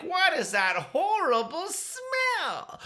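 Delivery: high, slow, drawn-out disgust; disgusted kids. Acted. Classmates greeting Peter in class, slow high disgust. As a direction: stretch every word like the smell hurts.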